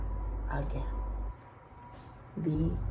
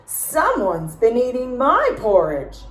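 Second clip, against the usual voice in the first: Papa Bear bellowing over his bowl; Papa Bear yelling; 6 semitones higher, 6 dB louder than usual. A narrator's reading. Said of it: "Papa Bear yelled".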